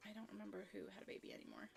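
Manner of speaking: fast, soft whisper under her breath; hushed, hurried confession; whispered aside. Real, not acted. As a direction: rush it out in a whisper. A quick aside to the viewers.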